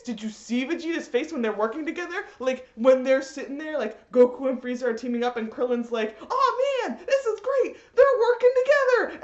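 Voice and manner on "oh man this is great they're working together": high, cheering gush of delight; mock-thrilled Krillin, pitched way up; excited Krillin.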